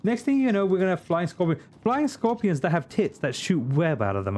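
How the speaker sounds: high, pleading rant; exasperated. Real, not acted. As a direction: beg the universe why.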